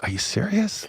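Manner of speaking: high, hushed disbelieving question. Retold or imitated. Youth pastor questioning his boss's offer, disbelieving.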